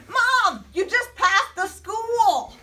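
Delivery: high, loud, drawn-out shout to Mom; yell. Acted. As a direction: holler it in alarm, stretching the words. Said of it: "I yelled".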